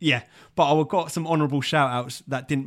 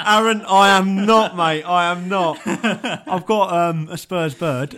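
laughing denial; 4 semitones higher, 6 dB louder, slower than usual. Real, not acted. Denies being Spurs fan, laughing.

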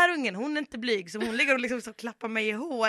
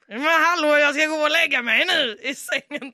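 higher, louder huffy protest; mock indignant protest; 6 semitones higher, 9 dB louder than usual. Mother protesting to her son, loud and indignant. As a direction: play the offended drunk mother.

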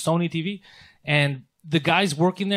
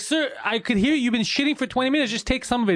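mock yelling; 7 semitones higher than usual. Clinic staff to a man stuck in the washroom, mock yelling. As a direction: imitate someone yelling through a door in a raised pitch. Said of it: "they're yelling at him".

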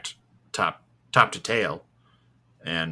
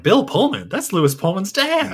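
surprised; 10 semitones higher, 4 dB louder than usual. Retold or imitated. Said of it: "I was like".

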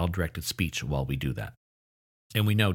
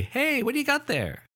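high-pitched greeting to a little one; adult cooing to a child. Acted. Adult greeting a child playing with a truck, high child-directed voice. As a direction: pitch it way up as if talking to a toddler. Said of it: "You probably say".

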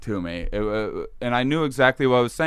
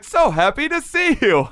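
much higher, louder happy voice; entity greeting; 5 dB louder than usual. Retold or imitated. Entity glad to see Shane, much higher and louder. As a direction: sing the welcome up high.

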